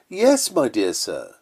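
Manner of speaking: slow, oily yes-man agreeing sweetly; mock flatterer. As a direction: lightly play the two-faced flatterer. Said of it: "it is again".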